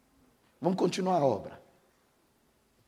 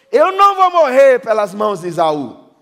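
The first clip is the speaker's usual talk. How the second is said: loud, high declaration; preaching defiance; 6 semitones higher, 12 dB louder than usual. Acted. Preacher voicing Jacob before Esau, loud and high. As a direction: proclaim it like Jacob standing firm.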